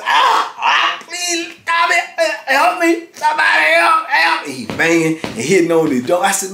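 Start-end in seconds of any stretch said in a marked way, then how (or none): 0.0-4.5 high frantic yelps, please, help; imitated terrified pleading. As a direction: mimic a choking man screaming for help. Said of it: "So he's like".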